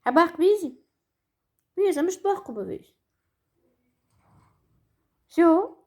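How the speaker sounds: squeaky helium-high voice; talking after breathing helium. Real, not acted.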